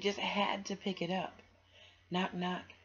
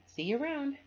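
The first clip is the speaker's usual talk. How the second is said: high, louder friendly goodbye; cheerful boy voice; 11 semitones higher, 6 dB louder than usual. Acted. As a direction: wave goodbye brightly. Friend cheerfully says bye.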